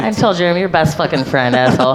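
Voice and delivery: normal talking voice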